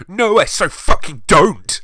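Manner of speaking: high, loud indignant shout. Acted. Girl denying her crush on Draco, shouting. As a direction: yell the denial like a flustered teen. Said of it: "I shouted".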